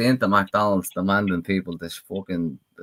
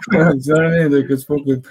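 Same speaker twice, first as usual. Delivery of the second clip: laughing loudly through the words; 8 semitones higher, 8 dB louder than usual. Real, not acted. Mate laughing hard at his own Garda story. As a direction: crack up and keep talking.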